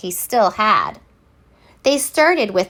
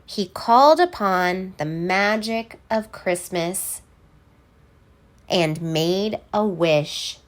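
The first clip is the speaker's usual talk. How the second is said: usual speaking voice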